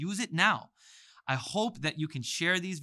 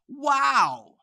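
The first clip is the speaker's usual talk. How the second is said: much higher, loud "Wow."; excited wow; 10 semitones higher, 9 dB louder than usual. Acted. Excited student reacts to easy letters. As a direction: exclaim in delight. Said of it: "He's already excited".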